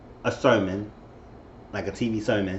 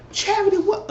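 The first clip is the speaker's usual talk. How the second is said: much higher, shocked "Charity, what?"; shocked high squeal. Retold or imitated. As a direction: shriek the name in disbelief. Voicing Charity's shocked reply.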